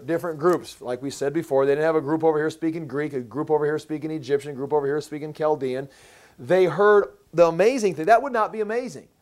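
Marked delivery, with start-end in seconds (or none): none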